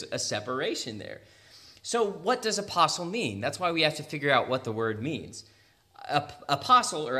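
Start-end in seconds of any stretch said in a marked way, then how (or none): none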